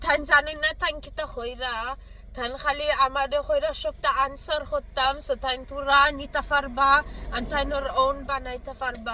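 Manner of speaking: put-on rapid comic Bengali accent; jokey Bengali accent. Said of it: "my jokey Bengali accent".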